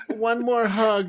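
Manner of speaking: put-on high, slow pleading child; whiny kid voice. Dad imitating his kid begging for a back scratch, high and drawn out. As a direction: beg like a sleepy kid stalling bedtime.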